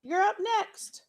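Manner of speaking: high, drawn-out playground call. Acted. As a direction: call it across the yard. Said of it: "Emilio calls out from the".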